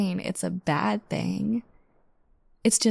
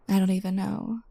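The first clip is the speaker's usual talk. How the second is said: usual speaking voice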